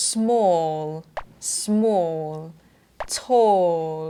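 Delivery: SMALL and TALL with a long rounded o. Shown as a demonstration. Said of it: "ปากก็จะกลมเหมือนกัน แต่ว่าจะเสียงยาวนะ". (small, tall ปากกลม ลากเสียงออยาว)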